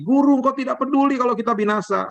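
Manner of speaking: high frantic plea to a sleeping teacher; panicked disciples. Retold or imitated. Disciples waking Jesus in the storm, high mock panic.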